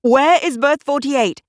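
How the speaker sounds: loud high call. Acted. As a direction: call out to a steward. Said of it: "Amarnath called out".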